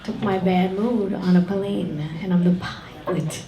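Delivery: ordinary talk, nothing marked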